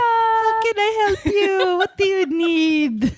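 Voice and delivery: put-on high, slow, singsong Filipino accent; imitating a helpful Filipino-accented operator. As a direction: stretch it out in an eager Filipino-accented phone voice. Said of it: "I turn on an accent".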